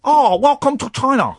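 high, put-on Chinese accent; hammed-up Chinese accent as a comic greeting; mock Chinese accent. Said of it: "I'm gonna do the voices".

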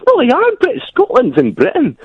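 very high, indignant Scottish squawk; mock protest. Diner insisting to the waiter about Scottish pounds, mock protest. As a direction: object in a shrieky Scottish pitch.

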